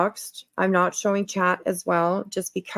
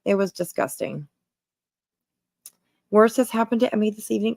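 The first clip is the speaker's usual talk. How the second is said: normal talking voice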